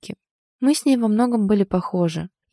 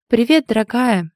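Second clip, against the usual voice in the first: louder, higher, perky hello; perky greeting; 5 semitones higher, 6 dB louder than usual. A narrator's reading. Friend answering the heroine's call, perky. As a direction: greet a friend with playful pep. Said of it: "услышала я её задорный голос".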